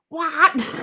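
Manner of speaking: high laughing shout of shock; surprised scream. Retold or imitated. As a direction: yell it out in disbelief, laughing. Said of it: "I literally screamed".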